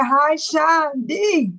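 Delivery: slow, drawn-out sing-song hello; sing-song greeting. Retold or imitated. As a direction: stretch the greeting out playfully. Reading chat greeting aloud.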